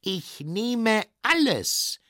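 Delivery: high, drawn-out dragon voice; slow, high dragon declaration; eager dragon. Acted. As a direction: claim it all, slowly and high. Dragon eagerly buys whole stand.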